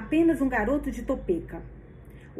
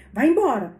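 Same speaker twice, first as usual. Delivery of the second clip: grumbling; 3 semitones higher, 9 dB louder than usual. Acted. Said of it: "resmungou Wallace".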